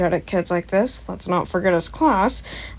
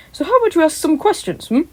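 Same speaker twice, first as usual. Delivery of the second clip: big booming hero voice, pitched up and loud; All Might; 3 semitones higher, 6 dB louder than usual. Acted. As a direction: play the cheerful giant hero coaxing a scared kid. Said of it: "All Might said".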